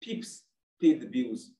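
each word of "Pips pay the bills" spaced out slowly; a trader's creed read out slowly; slow motto. Shown as a demonstration. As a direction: recite it like a slogan, word by word. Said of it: "We always say".

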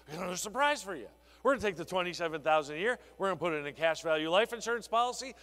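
fast, eager pitch with punch on surprise; demo delivery. Shown as a demonstration. Advisor shows trainees how to spring the surprise, fast and eager. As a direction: rush it like a salesman revealing a secret, stress on surprise. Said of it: "See, you do it like this".

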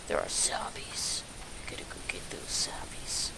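hushed, creepy whisper; spooky whisper. Pretend. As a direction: whisper it like a secret threat. Spooky aside about zombies.

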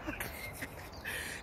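soft, breathy laugh; hushed chuckle. Real, not acted. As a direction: let out a quiet laugh. Laughs at own mimicry.

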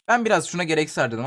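ordinary talk

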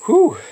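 high sharp whew of pain; sharp exhale from heat. Real, not acted. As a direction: blow out the burning heat. Eating a super hot pepper.

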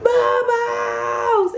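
very high, drawn-out call; mock high-pitched yell for a runaway pet; imitated calling out. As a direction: call the dog from down the street in a high holler. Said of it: "I called her".

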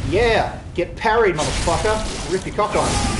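loud, high victory shout; triumphant yell. Real, not acted. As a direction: gloat over the beaten enemy. After a successful parry.

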